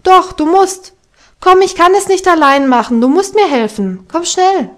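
hoch und laut, dringlich bittend; dräng ihn, lass nicht locker; drängend. A narrator's reading. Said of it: "drängte das Heidi". (Heidi drängt Peter laut, ihr zu helfen)

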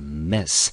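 "mess" said as one short syllable with an open e, like the word mess; teacher modelling the syllable "mess" slowly; first syllable mess. Shown as a demonstration. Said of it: "我们念成mess".

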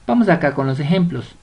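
ordinary talk

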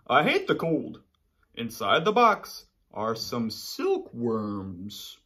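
panda's letter read aloud. Acted. Reading panda's reply letter.

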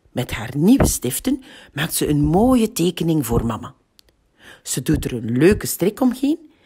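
plain reading voice

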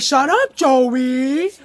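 high, loud mock yell; aunt's yell. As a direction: shriek it and stretch the name. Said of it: "yelling at me".

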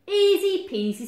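high, loud, chirpy sing-out; small animals breezing through; carefree little creatures. A narrator's reading. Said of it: "said the smaller woodland creatures as they skipped".

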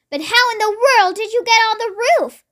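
high-pitched, baffled question; astonished cry. A narrator's reading. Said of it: "cried the mother".

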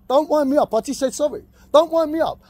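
high-pitched, heated protest; exasperated warning. Real, not acted. Man warning someone after saying sorry, exasperated. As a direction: protest it hotly, voice jumping up. Arguing after having apologised.

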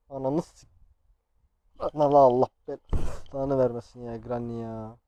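loud, drawn-out exasperated outburst; startled cursing. Real, not acted. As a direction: burst out slowly at the enemy. Startled and caught by Granny. (irkilip yüksek sesle beddua)

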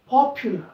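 Popular with POP hit hard; popular stressed on the first syllable. Shown as a demonstration. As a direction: punch the start of popular. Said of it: "पहिल्याला जोरात म्हणा".

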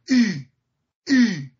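ای said alone, loud; ee vowel. Shown as a demonstration. Teacher showing how Eastern Europeans say او. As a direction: say the vowel ای clearly.

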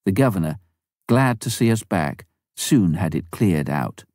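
ordinary delivery

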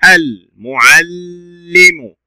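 المعلم very slowly, holding the doubled l before li-mu; shadda demonstration. Shown as a demonstration. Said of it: "ننطق الشدة ثم كسرة".

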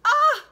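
sudden high loud yelp; a short scream as she slips; falling cry. Acted. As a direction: grandmother crying out as she falls, high yelp. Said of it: "just a faint cry".

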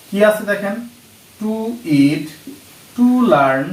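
ordinary delivery

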